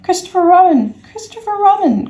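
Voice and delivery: very high, urgent calling; crying out. Acted. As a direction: call for rescue in a high little voice. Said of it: "cried Piglet".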